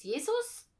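high, loud questioning pipe-up; child's blurted guess. A narrator's reading. Boy guesses who stays at the inn, loud and questioning. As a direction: pitch it up sharply as a question.

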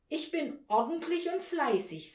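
high, keen self-praise; eager. A narrator's reading. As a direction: answer eagerly like a good child. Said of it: "nickte sie sofort eifrig".

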